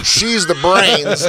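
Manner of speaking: slow, high mock-presenter delivery; corny pitch intro. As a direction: stretch it like a rehearsed cheesy couple joke. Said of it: "they still have the corny thing though".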